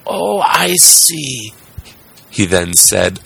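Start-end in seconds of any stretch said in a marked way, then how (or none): 0.0-1.5 high, drawn-out realisation; amused old carpenter. A narrator's reading. Carpenter brushing off the voice, high and slow. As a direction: slowly let it dawn, pitched high. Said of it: "laughing and scratching his wig".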